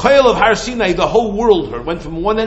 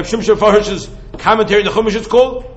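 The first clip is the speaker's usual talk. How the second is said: ordinary talk, nothing marked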